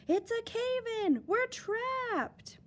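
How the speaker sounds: high, slow terrified shout; panicked cry. Acted. Miners trapped in the cave-in, high panicked shout. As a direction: scream it as the tunnel falls.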